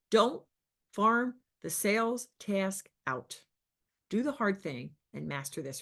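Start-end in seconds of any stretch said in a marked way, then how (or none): 0.1-3.4 slow, weighted "farm the sales task out"; emphasized. Shown as a demonstration. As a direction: lean on each word of "Don't farm the sales task out". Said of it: "I really wanna emphasize that".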